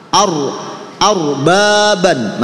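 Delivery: slow drill, "arbaban" with a heavy r and long ba. Shown as a demonstration. Teacher models for learners to repeat, heavy-r "arbaban". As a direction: stretch "arbaban", thick r in "ar". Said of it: "ini karena ro tafkhim".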